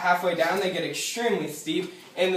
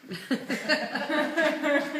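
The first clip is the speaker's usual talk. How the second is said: laugh; 9 semitones higher than usual. Real, not acted. Laughs at winery joke.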